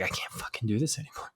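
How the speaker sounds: very soft, low broken-down voice; mock tearful. Imagined YouTuber breaking down on camera, mock tearful and hushed. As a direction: pretend to give up in tears, hushed. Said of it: "crying and you're just like".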